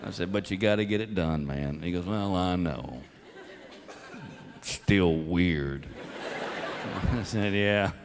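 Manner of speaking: hushed father-son talk. Retold or imitated. Quiet talk driving home.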